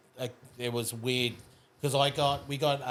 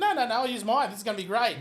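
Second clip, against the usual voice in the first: high, cocky insistence; confident brag; 11 semitones higher, faster than usual. Retold or imitated. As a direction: show off that you're paying. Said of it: "I was like".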